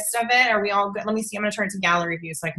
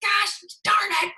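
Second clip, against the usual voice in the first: mock frustrated outburst; 11 semitones higher than usual. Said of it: "I'd be like".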